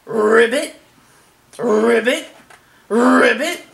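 loud rolled croaks, each rising; practicing ribbits. A narrator's reading. Little pig practicing his frog sound, croaking. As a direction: trill each ribbit separately like rehearsing. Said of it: "practicing his sound".

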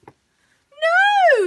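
very high, loud refusal; long high moo of protest; stubborn cow. A narrator's reading. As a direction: bellow it like a tantruming cow. Cow refuses the sales assistant.

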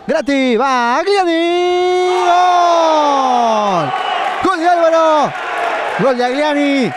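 shouted goal call in another commentator's style; Lorenzi goal shout. Acted. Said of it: "Le hago el grito de Lorenzi".

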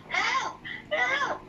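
high, panicked call of help; scared cry for help. Shown as a demonstration. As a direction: yelp it like a dog is chasing you. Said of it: "So how would you say it?".

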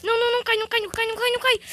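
high, rapid pleas not to fall; desperate pleading. Real, not acted. Gamer pleading as the character nears the edge. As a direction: beg it not to happen.

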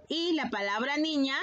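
ordinary talk, nothing marked

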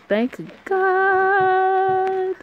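high, loud burst of relief; relieved cry. Real, not acted. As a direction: blurt it out, thrilled. Reader reacts to interrupted scene.

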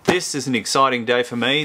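ordinary delivery

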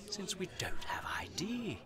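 hushed, breathy whisper. Acted. The ghost shows Paul their future, whispering the grim part. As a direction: drop to a whisper over the bleak picture. Somber narration of bleak vision.

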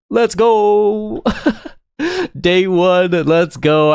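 high, celebratory whoop with a laugh; real delighted excitement; excited cheer. Real, not acted. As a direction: cheer a lucky second chance. Gets another chance after losing.

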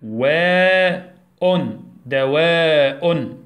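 very slow, split into د، و، أن then whole دواء; teacher leading class to read slowly; slow syllable blending. Shown as a demonstration. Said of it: "يلا ننطق مع بعض".

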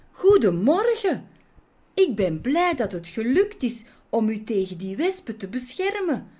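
high, cheery greeting, then quick chatter; satisfied little heroine reporting her success; pleased young bee. Acted. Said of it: "zei ze tevreden".

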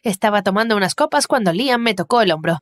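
ordinary delivery

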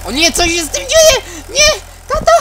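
high terrified shriek; panicked scream. Acted. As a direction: scream in sudden terror. Character panics as car goes wrong.

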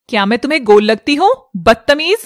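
huffy outrage; offended retort. Acted. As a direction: scold the rude vendor quickly and loudly. Woman offended by fruit seller.

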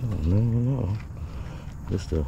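ordinary talk

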